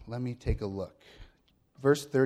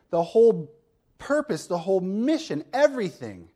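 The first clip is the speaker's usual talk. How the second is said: higher and louder, building fervor; fervent preaching; 6 semitones higher, 5 dB louder than usual. Acted. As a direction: preach it with rising intensity. Climax of sermon point.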